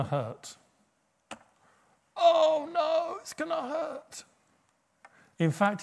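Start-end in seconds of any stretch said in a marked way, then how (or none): 2.2-4.2 squeaky, high mock panic; hammed-up dread in a much higher voice; mock fear. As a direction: whimper it like a scared child. Imitating own fearful reaction.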